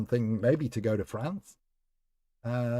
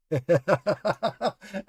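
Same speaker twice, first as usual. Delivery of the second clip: laughing; 8 semitones higher, 5 dB louder than usual. Real, not acted. Laughing at chat.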